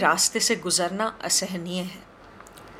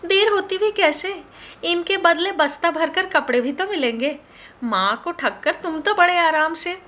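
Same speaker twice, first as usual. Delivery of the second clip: giggling tease; 9 semitones higher than usual. Acted. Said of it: "खिलखिलाती हुई बोली".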